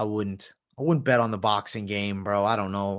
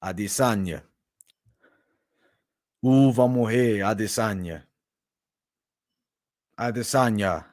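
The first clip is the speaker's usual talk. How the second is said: put-on low Brazilian Portuguese accent; Pereira's Brazilian accent; 4 semitones lower than usual. Fighter calling out Adesanya, low Brazilian accent. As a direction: intone the rival's name in a Brazilian accent. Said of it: "The Pahea accent".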